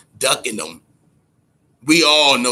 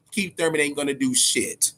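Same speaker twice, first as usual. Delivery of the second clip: ordinary delivery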